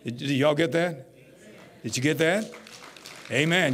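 ordinary delivery

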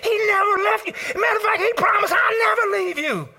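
high, loud shouting preacher; full-force preaching. Acted. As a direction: preach it out to the back pew.

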